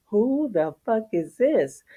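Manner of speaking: mock outrage. Dog imitated reacting to a stranger, mock outrage. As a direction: drag it out, hamming up the suspicion. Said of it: "they be like".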